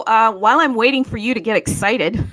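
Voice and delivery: usual speaking voice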